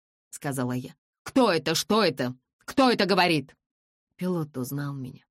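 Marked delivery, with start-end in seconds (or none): 1.2-3.6 loud, urgent, higher questioning; startled blind man; alarmed questions. A narrator's reading. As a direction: demand who is speaking.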